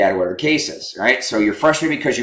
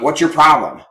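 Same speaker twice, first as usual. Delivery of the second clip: louder, higher mock challenge; confrontational husband; 5 semitones higher, 5 dB louder than usual. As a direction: demand it like a tactless husband. Example of a triggering husband question.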